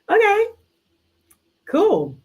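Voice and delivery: okay jumps way up in shock; a sudden high yelp of okay; surprised okay. Real, not acted. Reacting to the messy couple, high surprised okay.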